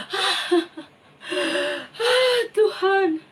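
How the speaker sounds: high, wailing tearful plea to God; a king weeping as he prays; crying prayer. Acted. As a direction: sob it out to the Lord. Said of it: "dia berdoa adik-adik sambil menangis".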